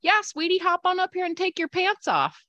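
high-pitched mock-sweet voice; sugary coaxing tattooer. Tattooer telling a client to hop up, sugary coaxing.

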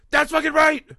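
high, loud cheering shout; screaming at the TV. Retold or imitated. Viewer screaming support for the dad at the TV. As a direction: scream it at the screen like a fan. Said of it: "screaming at the T V".

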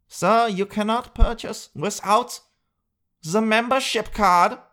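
high, slow officious cashier voice; mock uptight store clerk; prissy cashier. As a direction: refuse him primly, word by word. Cashier refusing.

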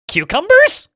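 "Cucumbers" shooting up high and loud at the end; "Cucumbers" in a high, rising mock scream; incredulous question. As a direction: cry "Cucumbers" as a hugely exaggerated question. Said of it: "question mark, question mark".

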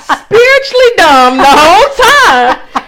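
high, loud comic punchline; high and loud self-mocking punchline. Real, not acted. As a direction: land the joke big. Punchline about herself.

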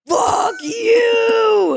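high, loud mock outburst; hammed-up furious driver; imitating his rage at the phone; angry old man. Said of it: "He would have been like".

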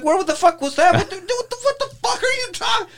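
retelling a stunned reaction; mock disbelief. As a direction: squeak out your disbelief. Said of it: "I was like".

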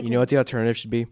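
rising preacher cadence, fast and loud; pastor voice. Acted. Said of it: "You sounded just like our pastor".

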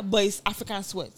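ordinary talk, nothing marked

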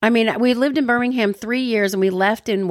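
normal talking voice

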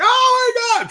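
sudden high shriek of alarm; high, loud startled cry; panicked oh my god. Retold or imitated. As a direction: yelp it in shock. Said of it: "We're like".

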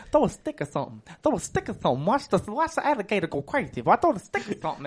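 "stick or something" in a lisping guide's voice; imitating a lisp. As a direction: lisp through "Throw a stick" like the cocky tour guide. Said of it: "he had, like, a list. He was just like, he would talk like this".

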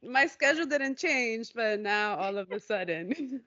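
amused, words breaking into laughs; laughing. Real, not acted. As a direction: laugh at your own excuse as you say it. Laughing at her own excuse.

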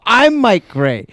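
mild mock announcement, slow and loud; retold answer to the nurse calling his name; announcing himself. Telling the nurse he is Mike Gray, slow and loud. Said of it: "I get up, I go".

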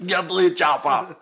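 badly faked Austrian movie catchphrase; Arnold Schwarzenegger. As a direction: have a laughing go at an Austrian action hero. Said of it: "Arnold Schwarzenegger accent?".

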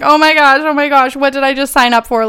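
half-hearted retold sobbing; imitating bawling. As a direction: replay the panic with a hint of tears. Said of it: "bawling my eyes out".

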